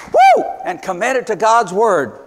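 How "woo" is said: a high, loud "woo" of delight; joyful whoop. Real, not acted. Preacher whooping for joy about the couple's faith.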